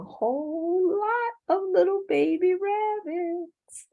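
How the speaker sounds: high sing-out, far from plain voice; cutesy narration. A narrator's reading. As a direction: coo over a crowd of tiny babies. Narrator cooing over baby rabbits.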